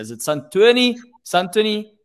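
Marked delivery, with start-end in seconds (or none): 0.2-1.0 "Santoni" as a tentative first guess, higher and louder; offering "Santoni" for correction; trying pronunciations. Shown as a demonstration. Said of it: "Can you please correct us here?". 1.2-1.9 "Santoni" tried higher and louder; testing out the name "Santoni"; trying pronunciations. Shown as a demonstration. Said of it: "how do we actually say your name".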